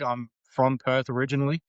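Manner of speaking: ordinary talk, nothing marked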